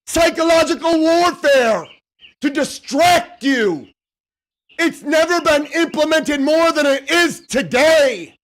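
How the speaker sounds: forceful preacher-style yelling; fiery conviction. Real, not acted. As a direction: blast it out to wake their souls. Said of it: "But when I fucking speak like this".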